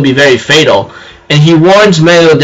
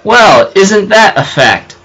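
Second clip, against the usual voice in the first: slow knowing remark; 4 semitones higher, slower than usual. Retold or imitated. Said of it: "And I go like".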